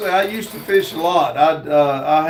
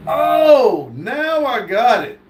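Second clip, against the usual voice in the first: the penny drops all at once; sudden realization; 5 semitones higher, slower than usual. Real, not acted. Finally getting the cow joke, surprised. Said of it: "I was reading and missed everything".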